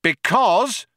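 high, projected announcement; cop shouting. A narrator's reading. Cop begins explaining his threat, shouting. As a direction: call it out emphatically. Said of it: "shouted the cop".

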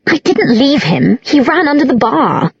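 high, whining protest; high defensive tipsy voice. A narrator's reading. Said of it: "Isabel protested".

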